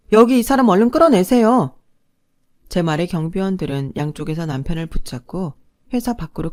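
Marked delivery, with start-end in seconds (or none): 0.1-1.8 louder, higher urgent order; commanding shout. A narrator's reading. Ex-wife ordering guards to remove ex-husband, commanding. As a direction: call out to the guards, brooking no delay.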